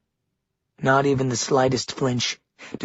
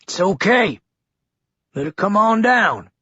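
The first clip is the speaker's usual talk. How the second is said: rough, gravelly man's voice; 7 semitones higher, 4 dB louder than usual. A narrator's reading. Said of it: "the sound of a gravelly voice".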